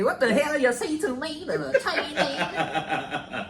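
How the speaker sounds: high laughing mock Chinese accent. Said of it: "I can do a Chinese accent".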